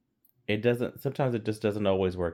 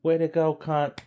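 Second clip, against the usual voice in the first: cheery British guy; 7 semitones higher than usual. Retold or imitated. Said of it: "And he's like".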